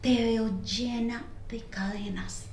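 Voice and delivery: slow, hushed prophecy; solemn whisper. Retold or imitated. The mother-in-law whispering a prophecy to her. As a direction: whisper it like a revelation. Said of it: "me dice".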